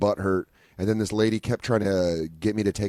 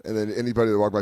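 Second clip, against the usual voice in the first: ordinary talk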